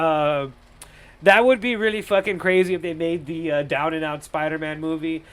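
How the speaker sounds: ordinary delivery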